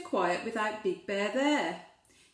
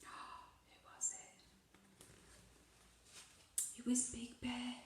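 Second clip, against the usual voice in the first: whisper; 18 dB quieter than usual. A narrator's reading. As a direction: draw the child in with a secret whisper. Suspense before door opens.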